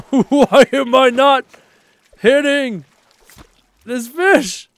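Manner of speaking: loud, high, slow laughing complaint; laughing frustration. Real, not acted. Streamer laughs at failing to spear fish.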